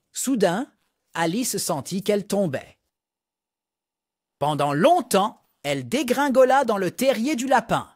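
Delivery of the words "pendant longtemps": usual speaking voice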